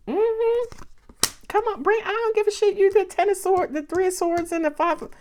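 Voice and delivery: gushing over the cards with real excitement; fired-up and squealing with joy; excited. Real, not acted. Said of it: "I'm getting excited".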